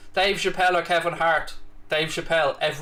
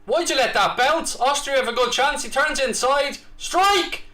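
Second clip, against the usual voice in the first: high, building commentator call; excited commentary; 9 semitones higher than usual. Real, not acted. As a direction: rise toward a shot. Live commentary on a chance.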